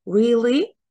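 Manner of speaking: "really" lifted at the end like a question; questioning rise. Shown as a demonstration. Teacher showing how to check information, questioning "really". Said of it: "It's like a kind of a question".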